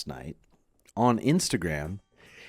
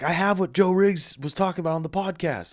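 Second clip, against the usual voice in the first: high, put-on voice of a friend lost in his trip; imitating tripping friend; 9 semitones higher, 3 dB louder than usual. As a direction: voice the dazed buddy, pitched up. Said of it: "And he's like".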